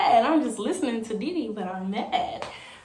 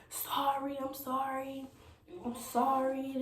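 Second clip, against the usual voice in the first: imitating his crying apology; 5 semitones higher, 5 dB quieter, slower than usual. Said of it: "he crying".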